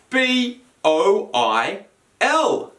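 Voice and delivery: letters spelled out one by one, slowly. Shown as a demonstration. Teacher spelling boil to pupils, letter by letter slowly. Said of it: "leaves us with the word boil".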